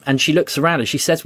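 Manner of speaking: normal talking voice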